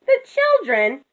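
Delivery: high, gently imploring; high-pitched, coaxing appeal; pleading. A narrator's reading. Moo pleads with the rooster.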